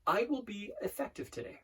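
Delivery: soft, measured self-affirmation; affirmation demo. Shown as a demonstration. Coach demonstrating a shorter affirmation, soft and measured. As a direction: say it softly to yourself, pausing between. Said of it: "I'll give you a shorter one".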